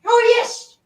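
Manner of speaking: very high squeaky mouse puppet voice; high puppet squeak, sweet and cheeky; Topo Gigio voice. Retold or imitated. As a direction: squeak it out like a tiny Italian mouse. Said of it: "I can't do Topo Gigio".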